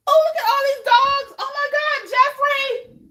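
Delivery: high-pitched yelp of fright; suddenly scared of dogs in the yard; real fright. Real, not acted. Host spotting a pack of dogs outside, shrieking high in real fear. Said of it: "Y'all know I'm terrified".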